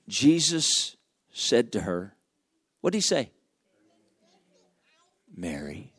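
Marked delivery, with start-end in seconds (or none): 5.3-5.9 much softer and lower, gentle; tender whisper of a name. Acted. Jesus calling Mary gently by name, soft. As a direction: say her name softly, with love.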